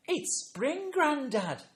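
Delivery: put-on high, loud small-child voice; imitating a young child; little granddaughter. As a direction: pipe up like a toddler explaining to Grandad.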